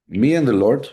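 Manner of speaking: ordinary talk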